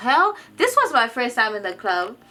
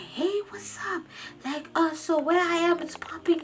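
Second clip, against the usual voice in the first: lightly voiced party friend; 8 semitones higher, 4 dB quieter than usual. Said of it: "He's like".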